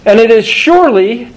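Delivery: surely lifted and stressed. Shown as a demonstration. Preacher stressing "surely" to his congregation. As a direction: lean on the word "surely" with a rising lift. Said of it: "two words of emphasis".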